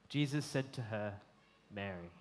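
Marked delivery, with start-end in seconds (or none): 1.7-2.1 low, soft utterance of a name; simply spoken name. Acted. Jesus speaks to the crying woman, simply and softly. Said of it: "He simply speaks her name".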